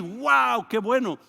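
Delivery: high, loud burst of delight; delighted exclamation. Acted. Preacher cheering the crowd's hope, high and loud. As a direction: exclaim as if thrilled by the news. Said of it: "preacher's own exclamation".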